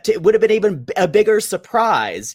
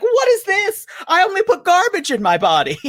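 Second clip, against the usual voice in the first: mock disgusted diva; 13 semitones higher than usual. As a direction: squeal it out, laughing, as a snooty diva. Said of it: "She's like".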